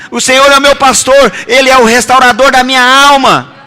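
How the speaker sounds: high, insistent preacher's proclamation; impassioned preaching. Real, not acted. Preacher telling congregation what they can claim, forceful declaration.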